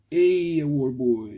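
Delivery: excited hail. Retold or imitated. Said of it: "And I'm like".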